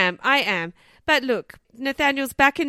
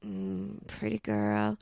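imitated dirty talk; 2 semitones lower, 9 dB quieter, slower than usual. Host imitates a man talking dirty to his car, softly. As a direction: purr it quietly in mock seduction. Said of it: "doing the dirty talk again".

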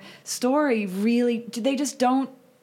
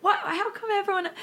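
laughing disbelief; 13 semitones higher than usual. Retold or imitated. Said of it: "and I'm like".

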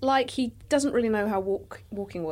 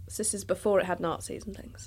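whispered aside; 3 semitones lower, 8 dB quieter than usual. Real, not acted. Joking aside in history intro.

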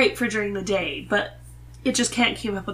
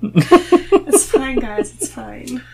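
loud, high sing-song "it's fine"; mock-cheery reassurance; 4 semitones higher, 9 dB louder, much slower than usual. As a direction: tease her with an overdone it's fine.

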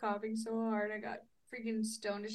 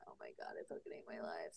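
whispered, much lower and softer, hopeless; despairing whisper; 13 dB quieter, faster than usual. Retold or imitated. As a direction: breathe it out in defeated misery. Retold despair missing freeway exit.

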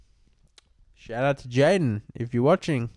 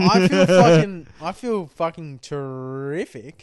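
tipsy, slurring slightly; 8 dB louder than usual. Real, not acted. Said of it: "You're drunk as fuck".

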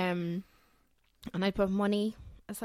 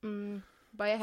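chewing while talking; 5 dB quieter than usual. Real, not acted. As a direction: mouth full of cake. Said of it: "she's eating a chocolate cake".

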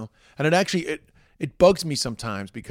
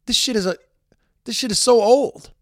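exasperated; 10 semitones higher, 8 dB louder than usual. Real, not acted. Exasperated outburst about unionists.

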